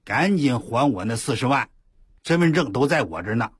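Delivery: loud menacing demand for money; bully them into paying back; a loud retold threat, lightly voiced; threatening debt collector.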